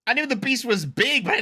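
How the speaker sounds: loud, stunned God damn; surprised exclamation. Real, not acted. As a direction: blurt it out in shock. Reacting to the Beast's size.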